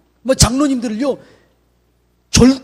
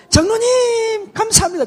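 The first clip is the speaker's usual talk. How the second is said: much higher, slow, tearful gratitude; tearfully moved thanks; 14 semitones higher, slower than usual. Shown as a demonstration. Pastor shows elders how thanks should sound, high and tearful. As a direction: thank him as if moved to tears. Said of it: "그렇게 하면 안 돼".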